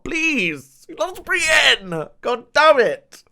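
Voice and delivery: high, drawn-out wail of frustration; frustrated fan wailing, ending loud; exasperated plea. Real, not acted. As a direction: plead in a high, stretched-out voice. Exasperated plea about the show.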